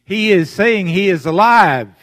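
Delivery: full-voiced sermon cry; preaching shout. Real, not acted. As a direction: shout it out to the congregation. Preacher proclaiming resurrection.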